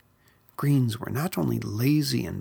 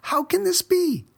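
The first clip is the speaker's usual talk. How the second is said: very high, loud dismay; shocked; 4 dB louder than usual. Acted. Verdi finding himself turning green, high loud disbelief.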